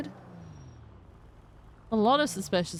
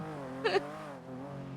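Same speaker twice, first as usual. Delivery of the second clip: breathy giggle; 11 semitones higher, 9 dB quieter than usual. Real, not acted. Laughing at silly car.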